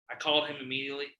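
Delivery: ordinary delivery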